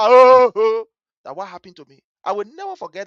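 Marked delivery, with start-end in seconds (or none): 0.0-0.9 loud mock wail; drawn-out "oh", hollered in imitation; imitated holler. Slapped child finally getting breath to cry, loud holler. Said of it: "for you to holler".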